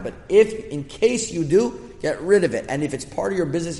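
rousing call to the class; fervent preaching. Acted. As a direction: press them hard to act now. Urges class to quit Instagram.

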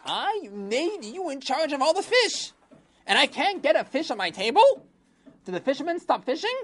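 high, loud scolding king; high shouting king rising to a demand; angry king. Acted. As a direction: berate the minister like a furious king.

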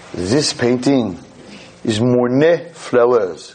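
slow, lightly French "Monet flowers" said "mɔɹne flɛləz"; mock French accent. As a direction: present it like a grand French guide. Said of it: "For all those who don't speak French".

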